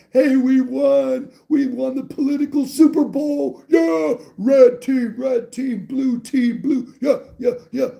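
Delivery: high, loud, hammed-up victory cheer; mock cheering fan. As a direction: crow like a fan whose team just won the Super Bowl. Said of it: "Not like".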